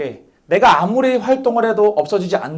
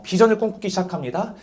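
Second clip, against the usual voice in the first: ordinary talk, nothing marked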